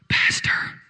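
loud, urgent mock whisper; stage whisper. Husband phoning pastor late at night, loud stage whisper. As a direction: hiss it out voiceless but forceful.

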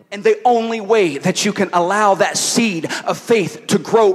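emphatic, projected sermon questioning; preaching. Real, not acted. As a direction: challenge the congregation, pressing each point.

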